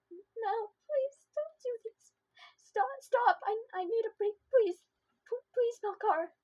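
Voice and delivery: scream-begging for it to stop; terrified pleading. Acted. Nightmare, begging Melkar to stop.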